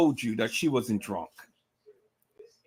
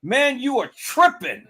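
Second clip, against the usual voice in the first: disgust; 9 semitones higher than usual. Retold or imitated. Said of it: "He said it with such disgust".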